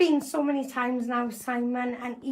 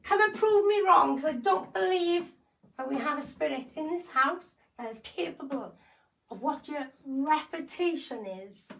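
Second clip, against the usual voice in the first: provoking call-out; 3 semitones higher than usual. Acted. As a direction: dare the ghost to prove you wrong. Challenging spirit to show itself.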